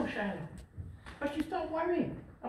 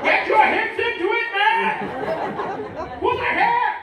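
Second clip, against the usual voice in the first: loud, higher coaching yell; 7 semitones higher, 9 dB louder than usual. Pretend. Coach at bedside to young man, loud yelling. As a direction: bark tips like a sideline coach.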